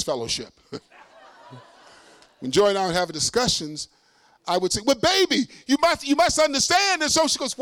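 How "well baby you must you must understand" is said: high, loud, insistent pleading; mock husband yelling. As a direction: re-enact a worked-up husband pressing his point. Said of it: "Why are you yelling?".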